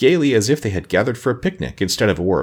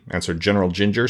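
plain reading voice

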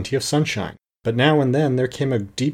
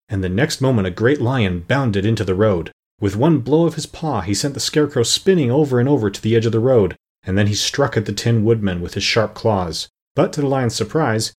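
ordinary delivery, nothing marked

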